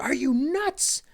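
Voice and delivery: high, slow disbelief; incredulous. Retold or imitated. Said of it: "the guy's like".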